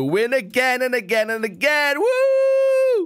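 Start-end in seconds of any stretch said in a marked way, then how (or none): 2.0-3.1 excited cheer. Real, not acted. Victory cheer for Team Blue.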